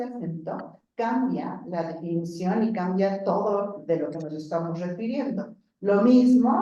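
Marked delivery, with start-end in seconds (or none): none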